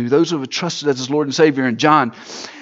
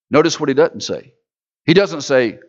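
ordinary talk, nothing marked

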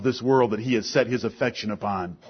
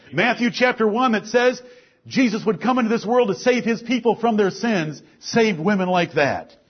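higher, louder preaching rising through the list; preaching at full force; 7 semitones higher, 6 dB louder than usual. Acted. Preacher rousing the congregation with saved sinners, full force. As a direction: preach it out, each name hitting the congregation. Preacher building over Bible women.

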